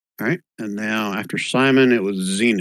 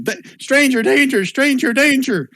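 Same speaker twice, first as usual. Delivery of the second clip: put-on high, insistent kid alarm cry; screaming children; 12 semitones higher than usual. Game master as kids facing a stranger, screaming. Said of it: "They're all screaming".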